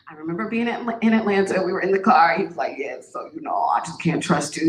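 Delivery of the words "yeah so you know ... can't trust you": low, lazy mock of the boyfriend; a lightly voiced dismissive man; imitating her ex. Boyfriend in the car, mock low breakup voice. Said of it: "this is how men talk to me".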